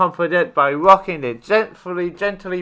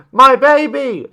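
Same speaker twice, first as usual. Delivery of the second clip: higher, louder desperate mother plea; pleading mother; 9 semitones higher, 8 dB louder than usual. A narrator's reading. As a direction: beg for her child back. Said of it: "she said pleadingly".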